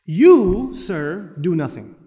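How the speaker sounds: usual speaking voice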